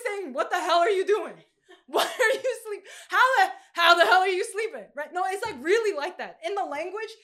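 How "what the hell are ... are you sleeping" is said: louder, laughing disbelief at a sleeping man; half-laughing what the hell; incredulous captain. Retold or imitated. Captain to sleeping Jonah, loud laughing disbelief. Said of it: "it's incredulousness".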